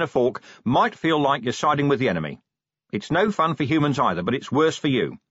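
rally the tired troops, plain-spoken and insistent; commander rallying officers. Acted. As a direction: watch commander's firm pep talk. Narrator voices Vimes addressing officers.

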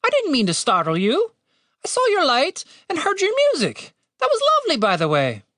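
high-pitched bird character voice; chatty apology in a high bird voice; polite talking bird. A narrator's reading.

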